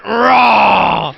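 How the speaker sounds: loud playful roar; monster roar. Retold or imitated. Speaker acting out scaring a little girl, loud mock roar. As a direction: roar to scare a little kid. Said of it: "and I went".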